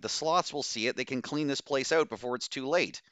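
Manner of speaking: high, impassioned plea; crying out. A narrator's reading. Doctor urges crew to let fire burn, impassioned cry. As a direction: urge them, pitch held high. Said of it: "Bose cried".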